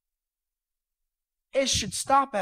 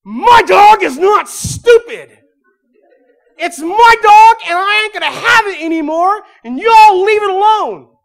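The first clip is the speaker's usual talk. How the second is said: furious outburst; 13 semitones higher, 18 dB louder than usual. Retold or imitated. Wife shouting at mocking family over her dog, furious yell. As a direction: defend the dog at full volume. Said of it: "I watched her slam her fist on the table".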